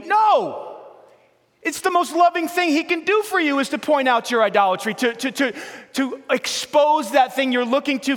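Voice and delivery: high, loud, driving preacher's cry; fiery sermon climax; preaching at full force. Acted. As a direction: hammer it home to the crowd.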